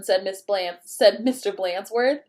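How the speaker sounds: ordinary delivery, nothing marked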